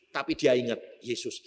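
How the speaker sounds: ordinary talk, nothing marked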